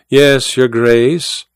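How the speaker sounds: slow, drawn-out servant's reply; obedient secretary. Acted. Secretary taking the Duke's order, dutiful. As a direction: answer slowly as a dutiful secretary.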